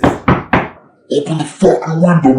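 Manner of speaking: slow, stern mock cop order; a slow threatening command; angry cop. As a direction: bark it out like a hostile cop. Retold angry cop demand.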